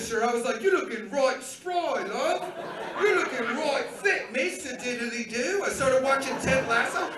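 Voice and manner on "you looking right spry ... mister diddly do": slow, loud, hammed-up British accent. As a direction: lay on a cartoon British accent, drawn out. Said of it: "I turned British".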